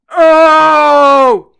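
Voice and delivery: pained yelp. Acted. Elephant bitten on the leg, loud startled cry.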